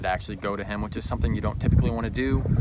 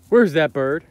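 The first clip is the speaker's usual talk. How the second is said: loud rising call; loud dog command; 3 semitones higher, 10 dB louder, slower than usual. Shown as a demonstration. Showing the future command to the dog, loud and rising. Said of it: "next time I do this".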